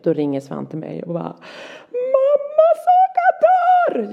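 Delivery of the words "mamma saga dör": high, loud imitation of a panicking boy; retold high-pitched frantic scream; panicked child's cry. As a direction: wail it like a child phoning his mum in panic. Son's panicked phone call.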